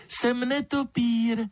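high-pitched little bat voice; thin piping bat. A narrator's reading. Bat introduces itself to the frightened lizard, thin piping voice. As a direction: speak in a small high pipe. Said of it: "hlásek tenký jako pípot ptačího mláděte".

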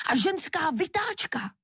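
high-pitched indignant devil; heated, incredulous objection; outraged exclamation. A narrator's reading. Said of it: "vykřikl".

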